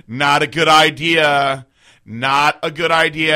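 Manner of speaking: loud, drawn-out warning; loud slow warning. Retold or imitated. Stranger warning her from across the street, loud and slow. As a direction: call out the warning slowly and loudly. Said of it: "and she goes".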